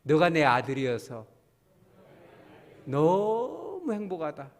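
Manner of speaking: 너무 stretched slow and fond; practice line for congregation. Shown as a demonstration. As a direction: lead them warmly through it. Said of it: "연습합시다". (회중과 함께 다정하고 느리게)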